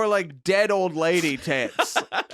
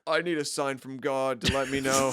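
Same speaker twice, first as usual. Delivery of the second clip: mock despair; 6 semitones lower, 3 dB quieter than usual. Acting out someone in despair.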